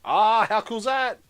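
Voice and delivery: loud, high delighted exclamation; excited. Real, not acted. Builder marvels at the working model, excited.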